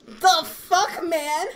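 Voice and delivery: high, loud mock outrage; astonished outburst. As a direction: demand it in a high, loud voice, breaking into laughter. Acts out astonished message she typed.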